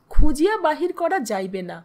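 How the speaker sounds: ordinary delivery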